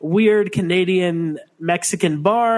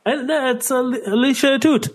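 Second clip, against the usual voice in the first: hammed-up Canadian accent; 7 semitones higher than usual. Co-host mocking a wrestler's name in a Canadian accent. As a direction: play it as a broad Canadian saying the name. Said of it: "You need to stop with the accents today".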